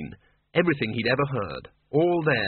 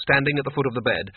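ordinary delivery

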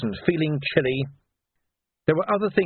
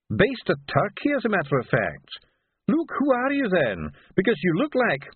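startled doctor; 7 semitones higher than usual. Acted. Doctor questioning the stranger at his door, high and puzzled. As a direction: stretch the words, pitched up in puzzlement.

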